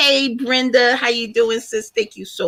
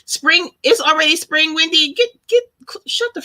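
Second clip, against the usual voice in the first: excited disbelief; 8 semitones higher than usual. Real, not acted. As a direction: blurt it out, stunned and thrilled. Realizing spring already started.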